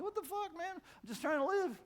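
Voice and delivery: high, pleading disbelief; exasperated Syrian civilian. Retold or imitated. Voicing an ordinary Syrian under the bombs, high and pleading.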